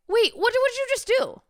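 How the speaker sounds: high-pitched alarmed interruption; startled confusion. Real, not acted. Host catching a change on the screen mid-explanation, startled. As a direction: break off, voice leaping up in confusion. Startled by what was just done.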